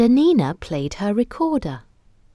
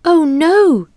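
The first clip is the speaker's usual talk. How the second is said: put-on dismayed child voice; 11 semitones higher, 6 dB louder than usual. Kipper sees friends captured.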